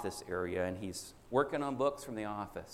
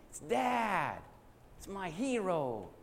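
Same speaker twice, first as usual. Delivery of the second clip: put-on high, thrilled child's cry, slowing on hero; a little boy bursting with joy at the door; excited boy; 7 semitones higher, much slower than usual. Little boy greets his dad home from work, high and thrilled.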